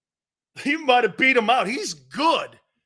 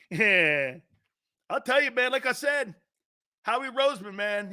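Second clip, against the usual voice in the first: ordinary talk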